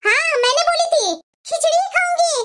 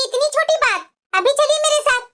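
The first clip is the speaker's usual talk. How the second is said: ordinary talk, nothing marked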